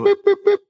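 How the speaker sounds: string of little imitated beeps; high-pitched boops. Host filling in around the web address, playful boops. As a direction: pop out short, high electronic-style boops.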